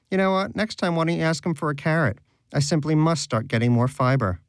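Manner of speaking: smug mockery. A narrator's reading. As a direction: tease him with mock refinement. Danish smug, mocking.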